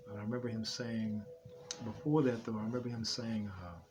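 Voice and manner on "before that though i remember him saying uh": usual speaking voice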